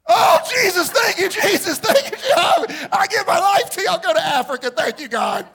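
shouted, high, overjoyed thanks; hammed-up parent shouting thanks to heaven; a parent overcome with joy, shouting; mock ecstatic praise. Parent's ecstatic reaction, mocked.

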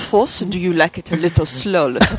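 playful put-on French accent. Shown as a demonstration. Player trying out her character's voice, French accent. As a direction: tease in a mock French accent. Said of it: "use a French accent with English words".

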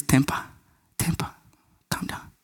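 soft, low calming voice; low murmur, dropping to nearly nothing; hushed soothing. Acted. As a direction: settle someone's temper very quietly. God soothing an angry parent.

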